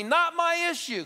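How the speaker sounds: rallying shout. Shown as a demonstration. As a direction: shout it out with the crowd. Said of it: "Shout with me".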